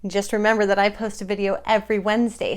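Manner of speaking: usual speaking voice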